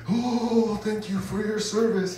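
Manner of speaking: high, slow, soft grateful lady; lightly voiced thankful lady. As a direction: thank him sweetly like a kind lady.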